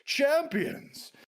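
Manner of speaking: high, loud, slow proclamation to the crowd; mayor's announcement yell. Acted. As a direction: announce the champions over a roaring crowd. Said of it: "and he yells".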